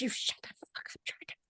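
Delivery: irritated whisper, acted out; hissed retort. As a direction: hiss it back under your breath. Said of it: "And she's like".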